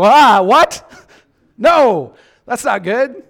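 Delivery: high, loud startled cry; a driver jolted by a shock; startled alarm. Pretend. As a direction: react in sudden alarm. Startled by GPS warning.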